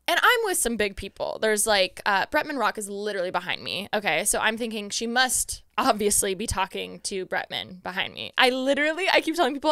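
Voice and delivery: ordinary talk, nothing marked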